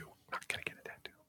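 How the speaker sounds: barely audible, fast whisper; whispered aside. Real, not acted. Host taking back the tattoo offer, whispered aside. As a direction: slip it in quickly under your breath.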